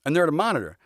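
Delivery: usual speaking voice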